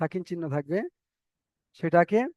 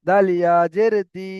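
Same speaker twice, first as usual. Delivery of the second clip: দাল ইয়া জের দি chanted louder, দি held; loud model recitation; 6 dB louder than usual. Shown as a demonstration. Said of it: "তাহলে এখানে বা টান হবে". (দাল ইয়া জের দি জোরে, দি টেনে)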